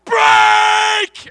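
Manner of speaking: very loud, high yelled order; captain roaring the signal to break; shouted command. Retold or imitated. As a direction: yell it across the changing room. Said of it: "Next minute he shouts".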